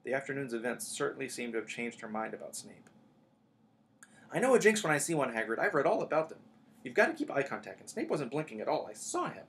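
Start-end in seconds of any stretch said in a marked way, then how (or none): 4.3-9.5 higher-pitched, urgent schoolgirl; insistent Hermione. A narrator's reading. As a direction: argue the case insistently, pitched up. Plays insistent Hermione arguing.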